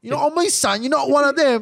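high, loud put-on Pommy accent. Shown as a demonstration. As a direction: show off an exaggerated English accent. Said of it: "I could do the best Pommy accents".

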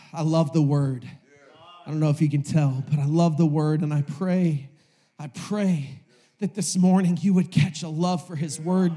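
earnest, low-pitched plea; heartfelt sincerity. Real, not acted. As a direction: drop low and confess it tenderly. Heartfelt confession and prayer after climax.

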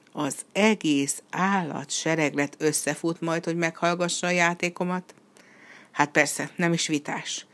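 plain reading voice

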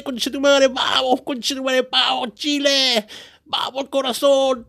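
high, impassioned football chanting in Spanish; fervent Spanish cheering. Retold or imitated. Chile fan alone in Australia, fervent Spanish cheering. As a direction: cheer at full pitch, like a die-hard fan. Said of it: "I'm talking in Spanish like this".

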